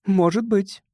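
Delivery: high-pitched female character reply; woman's voice. A narrator's reading. Anna answers Graham in dialogue.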